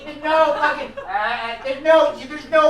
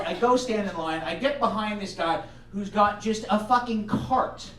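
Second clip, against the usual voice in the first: usual speaking voice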